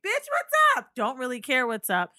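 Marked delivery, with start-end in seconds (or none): 0.1-0.9 high, loud mock greeting. To an old friend on the phone, a loud brash greeting. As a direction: shout it out brashly. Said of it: "I'm like".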